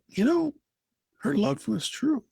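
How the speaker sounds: ordinary delivery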